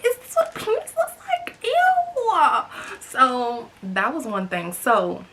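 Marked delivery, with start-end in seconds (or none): 0.0-2.6 squealing, much higher disgusted shriek; retelling her disgusted reaction to a photo; grossed out. Retold or imitated. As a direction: recoil in disgust at what you see. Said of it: "So I was just like".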